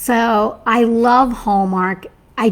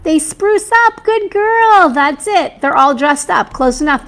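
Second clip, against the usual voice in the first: much higher, louder cheer; delighted high-pitched well done; excited praise; 6 semitones higher, 5 dB louder than usual. Real, not acted. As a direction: cheer her on for getting it. Cheering the right answer.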